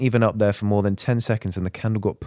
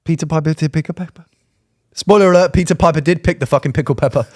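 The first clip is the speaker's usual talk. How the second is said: playful sing-song rant; mock tongue twister; 5 semitones higher, 4 dB louder than usual. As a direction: rattle it off loudly. Tongue-twister riff, put-on.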